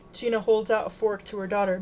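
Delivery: usual speaking voice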